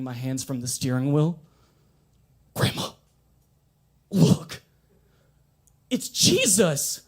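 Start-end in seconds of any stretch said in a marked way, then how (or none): 2.5-7.0 rising mock awe, slow and louder; hammed-up wonder, building high; mock amazed. Grandson fooling grandma in self-driving car, mock awe. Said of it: "pranking grandma in the car".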